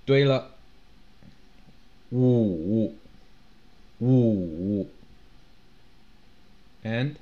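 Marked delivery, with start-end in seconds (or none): 2.1-4.9 "五" dropping low and coming back up; dipping third tone. Shown as a demonstration. Teacher giving third-tone numbers, low and dipping. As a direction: draw out "五" through a low dip. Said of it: "have the third tone".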